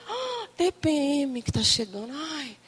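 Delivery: soft sigh fading away; mock dawning realisation. Woman realising her PMS is coming, softly mock-dismayed. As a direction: let it sink to a soft groan. Acts out sudden realisation, sighing.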